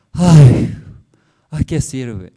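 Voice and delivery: loud imitated lament on the first ay; acting out a hopeless cry; mock wail. Believers weeping, quoted. (lamento fingido y fuerte)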